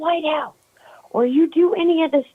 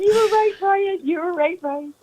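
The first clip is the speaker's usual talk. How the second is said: laughing; 7 semitones higher than usual. Real, not acted. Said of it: "Oh, my God".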